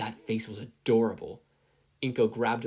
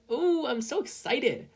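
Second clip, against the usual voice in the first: much higher, slow gushing; so excited; 4 dB louder, slower than usual. A narrator's reading. Izuku thrilled about the internship, high and gushing. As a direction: squeal it out with glee. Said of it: "Izuku vibrated in his seat".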